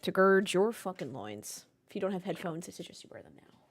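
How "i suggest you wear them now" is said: hushed warning whisper; a sly whispered aside. Pretend. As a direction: drop to a whisper as if passing on a secret. Warning listeners to wear headphones.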